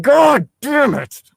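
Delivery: slow, loud, high outburst of frustration; loud, slow, fed-up curse; exasperated groan. Real, not acted. Reader groans at the scene.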